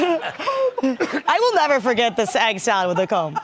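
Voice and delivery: talking through laughter; amused, still cracking up; laughing. Real, not acted. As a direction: say it while recovering from a laugh. Still laughing over comb joke.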